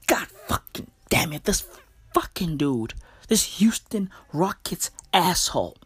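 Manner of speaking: hoarse mock-frustrated whisper of curses; whispered imitation of an angry Kobe; Kobe freaking out. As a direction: mutter it under your breath like a fuming star player. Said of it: "Kobe Bryant is somewhere freaking out. Going".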